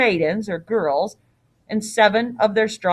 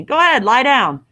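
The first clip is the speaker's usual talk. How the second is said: firm, higher and louder order; pushy innkeeper's command; 4 semitones higher, 6 dB louder than usual. Acted. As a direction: order a guest to lie down. Innkeeper orders Theseus to bed.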